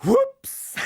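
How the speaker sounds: very high, loud yelp; a king caught out; the Tsar. Acted. Tsar seeing the proclamation, high yelp.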